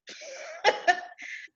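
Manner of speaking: high, breath-filled laughter. Real, not acted. Woman amused that her ex still called, high laugh. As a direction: laugh at the absurdity of it.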